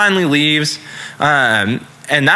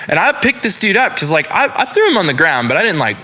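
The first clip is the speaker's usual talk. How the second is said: normal talking voice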